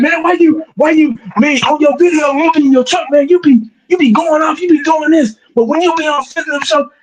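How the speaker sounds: lightly voiced complaining viewer; mocking fan impression. Fans mocking him, quoted.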